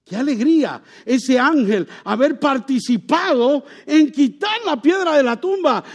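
high, loud, exultant proclaiming; joyful preaching. Acted. Preacher rejoicing over the angel's part, joyful preaching.

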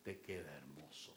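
low devil flattering her; devil's voice. Retold or imitated. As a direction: purr it like the tempter. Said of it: "el diablo como que me decía".

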